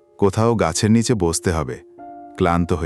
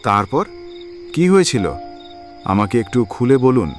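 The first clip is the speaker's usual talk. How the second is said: eager questioning. Acted. Ghost-seeker begs the stranger for his story, eagerly. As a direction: press for the rest of the tale.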